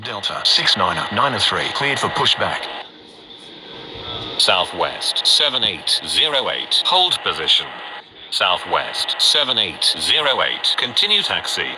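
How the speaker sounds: crackly military radio. Acted. Air traffic control radio calls.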